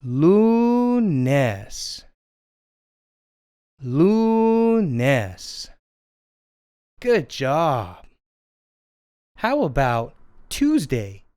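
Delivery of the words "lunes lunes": lunes stretched slowly into LOOO-ness; say-along pronunciation. Shown as a demonstration. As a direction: hold a high LU, then drop on nes. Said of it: "Come on, say it with me".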